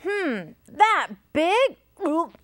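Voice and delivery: mild storybook gulp of alarm; lightly nervous elephant with a gulp. A narrator's reading. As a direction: question it high, then gulp. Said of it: "gulped the elephant".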